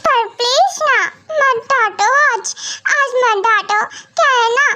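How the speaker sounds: put-on high, pouty little-girl voice begging daddy; childlike pleading. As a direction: whine like a small child who doesn't want mommy scolded. Little space girl begs again. (ऊँची, बच्चों जैसी रूठी आवाज़ में मिन्नत)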